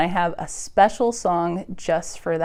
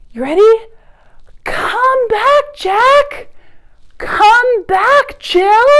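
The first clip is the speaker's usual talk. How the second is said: very high, louder call; calling loudly; 13 semitones higher, 7 dB louder, slower than usual. Acted. Librarian and kids call blackbirds home, loud and high. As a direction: call them home with all your voice.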